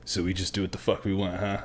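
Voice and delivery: quick, low, hushed mock instructor; imitated ear whispering. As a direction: mutter it fast into a recruit's ear. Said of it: "he's, like, whispering in my ear".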